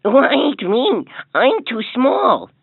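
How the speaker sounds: put-on light, young billy goat; little goat voice. A narrator's reading.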